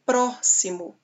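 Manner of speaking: "próximo" with a held, strong "pró"; stress on first syllable. Shown as a demonstration. Teacher showing the class the strong syllable, "próximo" stressed on "pró". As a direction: lean on "pró" and let the rest of "próximo" drop away. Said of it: "A antepenúltima sílaba forte".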